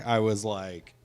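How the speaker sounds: usual speaking voice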